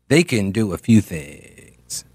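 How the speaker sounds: low, slow creaky drag; vocal fry. Acted. Host mocking public radio trend, slow vocal fry. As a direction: draw it out slowly in exaggerated vocal fry. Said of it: "for everybody to have vocal fry".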